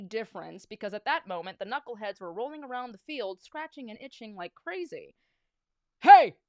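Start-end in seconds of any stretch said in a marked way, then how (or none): 6.0-6.4 very loud, high yell; explosive holler; coach's shout. A narrator's reading. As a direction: bellow it like an angry coach. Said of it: "shouted the coach".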